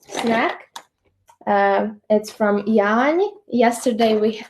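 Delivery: ordinary talk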